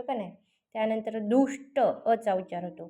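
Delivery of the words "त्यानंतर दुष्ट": ordinary talk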